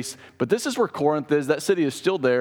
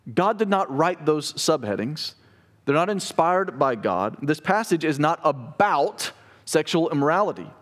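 ordinary talk

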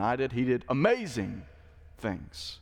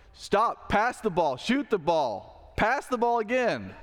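higher-pitched mock yell of a know-it-all fan; 7 semitones higher, slower than usual. Preacher imitating ignorant fans yelling at players, mock yell. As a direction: bark orders at the players like a fan in the stands. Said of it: "They're shouting at the players".

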